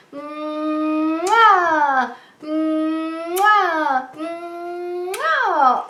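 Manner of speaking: high, drawn-out kiss sounds for the puppet; puppet blowing kisses. Pretend. Puppet Ollie blows kisses to the children, playful high smacks. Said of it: "Ollie's gonna blow his kisses".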